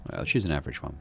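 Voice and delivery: ordinary talk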